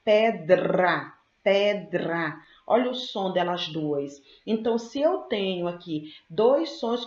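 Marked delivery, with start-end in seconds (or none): 0.0-2.3 "Pedra" drawn out, with a strongly sounded DR; D-R cluster emphasized. Shown as a demonstration. Teacher making students hear D and R, emphatic cluster demo. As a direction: sound out "pedra" so the D and R are both heard. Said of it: "Você ouve o som do D e o R".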